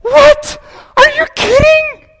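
put-on very high, loud squeal of a thrilled girl; delighted teen girl. Teen girl thrilled at getting her own car, high squeal. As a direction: squeal it like a sixteen-year-old who just got a car. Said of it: "So the girl".

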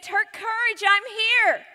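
loud, high, urgent reassurance; calling out. Acted. Jesus calling over the storm.

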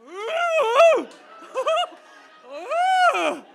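loud, high mock-alarmed "ooh, ooh". Hearing a stranger pray for her soul, high loud squealing ooh. Reacting to the prayer, laughing.

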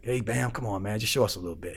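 soft, low coaxing voice; hushed, understated persuasion; quiet mafia boss. Retold or imitated. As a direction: imitate an old boss speaking very quietly. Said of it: "with the quiet voice".

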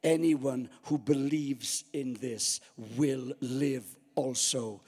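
low, slow, a hint of Jesus speaking gently; lightly voiced Jesus, low and unhurried; calm promise. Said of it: "he says".

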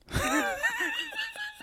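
shrill squeal of laughter, very high. Real, not acted. Host laughs at his own Fiat, high squealing laugh. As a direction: burst into a squealing giggle.

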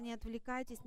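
usual speaking voice

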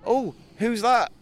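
unconvincing surprise. Acted. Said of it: "one more take on that with a bit more conviction".

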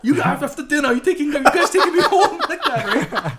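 high, laughing imitation of dad. Son mimics dad demanding ride home, laughing high voice. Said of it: "And he goes".

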